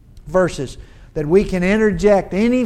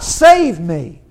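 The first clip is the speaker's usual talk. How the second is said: desperate cry for help; 8 dB louder than usual. Retold or imitated. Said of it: "he cries out".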